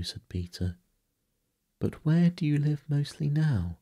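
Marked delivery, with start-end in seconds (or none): 1.8-3.8 put-on high, louder girlish question; inquisitive girl. A narrator's reading. Wendy plies Peter with questions, high inquisitive girl's voice. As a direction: pitch it right up, eager to know.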